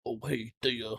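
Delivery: deep guy voice. Acted. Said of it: "Why did I make his voice so deep?".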